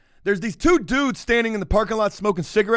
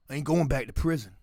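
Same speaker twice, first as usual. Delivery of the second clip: put-on 1 failed Boston accent; 7 semitones lower, 8 dB quieter, faster than usual. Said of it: "I can't do the Boston accent right now".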